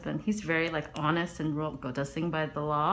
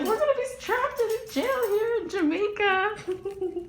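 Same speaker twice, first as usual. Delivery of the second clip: much higher, slow mock panic; scared husband; 5 dB louder, slower than usual. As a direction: whine it like a frightened husband. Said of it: "He was scared".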